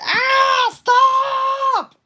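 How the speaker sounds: very high, loud acted shriek; mock scream. Person in the Willits family annoyed by the doll, mock scream. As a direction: scream it out long and piercing, hammed-up.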